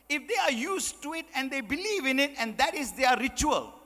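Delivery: normal talking voice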